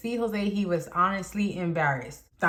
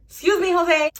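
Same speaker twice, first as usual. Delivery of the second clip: high, loud call to get attention; 8 semitones higher, 6 dB louder than usual. Retold or imitated. Narrator cuts in on the argument to call Jose, high loud call-out. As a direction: call over sharply, pitched up. Teller acts out calling to Jose.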